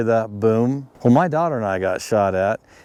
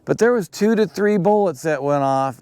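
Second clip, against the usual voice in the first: usual speaking voice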